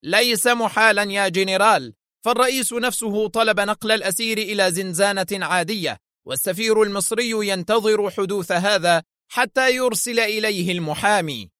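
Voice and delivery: high, insistent angry rebuttal. A narrator's reading. Director contradicting the general, angry. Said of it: "صاح به المدير في غضب".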